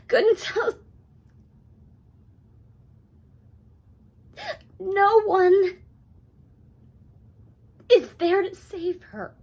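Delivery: high, loud, tearful outburst; anguished crying. Acted. Mother of an addict fearing no one will save her, anguished. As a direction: break down, crying out in despair.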